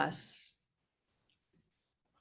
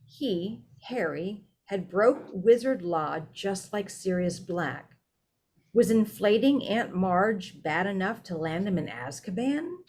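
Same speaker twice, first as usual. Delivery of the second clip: ordinary delivery, nothing marked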